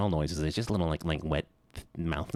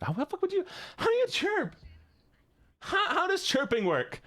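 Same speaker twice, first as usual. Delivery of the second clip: much higher, squeaked question; squeaky chirp attempt. Streamer trying to chirp like a T-Rex, squeaking high. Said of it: "trying to chirp".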